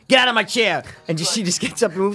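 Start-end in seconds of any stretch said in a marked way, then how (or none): 0.1-0.8 loud, higher bark of a cranky dad; mock dad yell. Retelling the dad's line to his friend, mock yell. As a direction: snap it like a dad kicking someone out of his seat. Dad shouting in retold movie scene.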